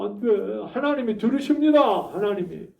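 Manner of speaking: ordinary delivery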